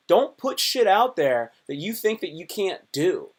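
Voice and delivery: ordinary delivery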